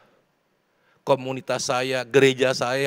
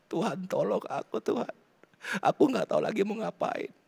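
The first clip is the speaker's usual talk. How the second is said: higher, soft, helpless plea; tearful prayer; 6 semitones higher, 5 dB quieter than usual. Acted. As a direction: beg God for help as if crying. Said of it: "Nangis aja di bawah kakinya". (doa memelas seperti menangis)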